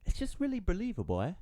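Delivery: British accent slipping into Australian; put-on Australian accent with a tagged-on "eh"; amateur Australian accent. Shown as a demonstration. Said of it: "not the Australian. I, I, I put the A in there".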